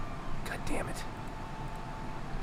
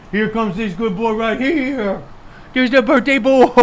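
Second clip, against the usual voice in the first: doting pet talk; 4 semitones higher than usual. Real, not acted. Owner greeting the birthday boy dog, doting baby talk. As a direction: coo excitedly, stretching the last word.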